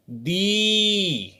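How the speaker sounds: दी with the ई held long; teacher models a long दी. Shown as a demonstration. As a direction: draw out the vowel in दी. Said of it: "दी नहीं पढ़ेंगे".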